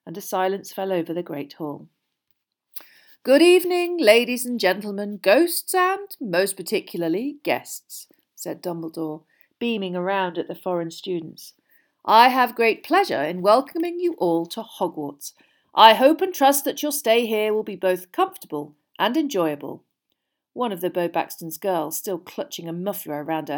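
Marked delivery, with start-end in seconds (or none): 3.2-8.1 loud, swooping headmaster's oratory; high opening, dropping to a stately close; grand welcome speech. A narrator's reading. Dumbledore addresses hall, beaming. 12.0-19.8 loud, lifted ceremonial voice; stately, projected speech to a hall; Dumbledore. A narrator's reading. Dumbledore welcomes foreign students.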